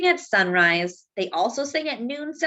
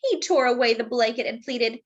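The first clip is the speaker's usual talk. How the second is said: plain reading voice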